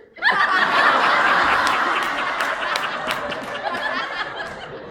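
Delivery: loud, high wheezing laugh; long breathless laughter. Real, not acted. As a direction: collapse into silent laughter. Punchline: remedy is milk.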